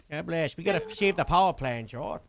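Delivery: normal talking voice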